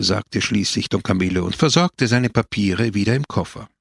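usual speaking voice

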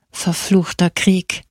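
lightly acted old man's growl, drawn out; growled curse. A narrator's reading. Old recruit cursing the war at the muster table, mildly growled. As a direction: growl it slowly, with a touch of grumpiness. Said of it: "knurrte einer der Alten".

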